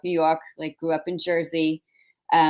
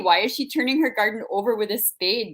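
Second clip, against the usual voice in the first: higher-pitched mock Minnesota accent; Minnesota neighbors; 5 semitones higher, 3 dB louder than usual. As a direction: gossip like a puzzled rural Minnesotan. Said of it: "my neighbors in rural Minnesota are like".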